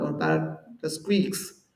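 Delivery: usual speaking voice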